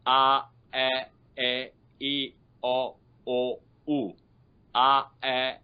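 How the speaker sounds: "A, A, E, I, O, U" as separate pure vowels on a level tone; detached, sustained vowel demonstration; individual vowel sounds. Shown as a demonstration. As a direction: sound out each vowel with a gap between. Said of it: "So the individual sounds".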